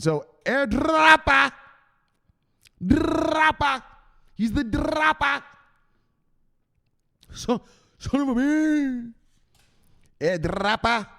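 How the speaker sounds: high, slow, stretched hype call; drawn-out cheer for his viewer; excited calling out. Real, not acted. Hyping a viewer named Airdropper, excited drawn-out call.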